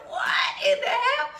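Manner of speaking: very high, loud squeal of disbelief; hammed-up high-pitched yell; shocked outcry. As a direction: shriek it in comic shock. Retold shock at own scraping heels.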